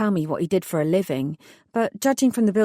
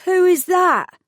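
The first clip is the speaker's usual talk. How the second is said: a much higher, louder frightened yelp; scared squeak; 12 semitones higher, 7 dB louder, slower than usual. Acted. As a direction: blurt it out like a petrified young lad. Petrified lad asks back.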